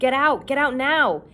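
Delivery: frantic warning scream. A narrator's reading. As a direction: shout it urgently, pitched high. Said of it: "he screamed".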